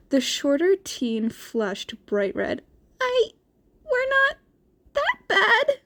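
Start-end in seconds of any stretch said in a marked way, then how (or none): 3.0-5.8 put-on high, embarrassed boy protesting; squeaky flustered denial; flustered Izuku. A narrator's reading. Izuku flushed red, protesting to Shinso in high voice.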